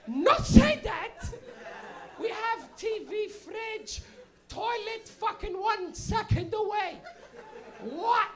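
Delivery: high, slow, indignant Portuguese mom; outraged Portuguese mother. Retold or imitated. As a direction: explode as an offended Portuguese mother, then list each item. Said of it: "she's a little Portuguese lady".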